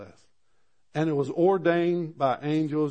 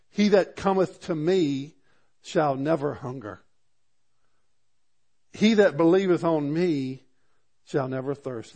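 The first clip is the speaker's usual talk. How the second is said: fervent sermon reading; preaching; 5 dB louder than usual. Acted. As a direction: proclaim it from the pulpit.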